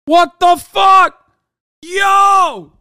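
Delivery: loud, high, slow shocked shout; stunned shout. Real, not acted. Streamer reacting to the spokesman's accent, shocked shout. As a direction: blurt it out in total disbelief.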